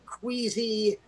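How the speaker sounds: ordinary talk, nothing marked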